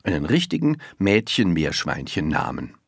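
ordinary delivery, nothing marked

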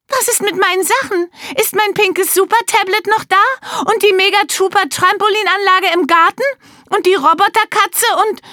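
a spoiled daughter fretting over her toys; spoiled girl. Acted. Candy whining to her father about her toys.

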